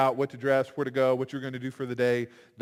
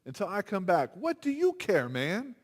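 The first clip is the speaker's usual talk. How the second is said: mildly irritated Jesus, pitched higher; a touch of annoyed rebuke, raised pitch; a little angry; 6 semitones higher than usual. Retold or imitated. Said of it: "little angry and goes".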